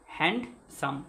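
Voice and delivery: হ্যান্ডসাম broken after হ্যান্ড; two-syllable split. Shown as a demonstration. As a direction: pronounce হ্যান্ডসাম as two separate beats, হ্যান্ড, then সাম. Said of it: "দুটো অক্ষর আছে না".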